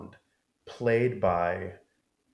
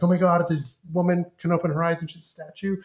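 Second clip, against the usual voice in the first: mock awed Louis; 7 semitones higher, 5 dB louder than usual. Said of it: "he's like".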